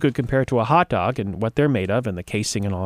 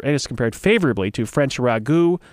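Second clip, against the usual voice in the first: normal talking voice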